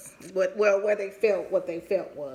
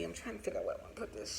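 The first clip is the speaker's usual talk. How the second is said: much softer, rapid aside to self; quiet aside; 13 dB quieter, much faster than usual. Real, not acted. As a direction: think aloud quickly under your breath. Aside to self mid-story.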